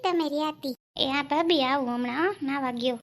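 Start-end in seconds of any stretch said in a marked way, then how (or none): none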